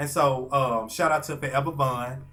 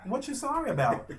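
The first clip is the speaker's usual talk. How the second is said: higher, puzzled question; lightly voiced Brandy, higher; imitating Brandy; 9 semitones higher than usual. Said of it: "she was like".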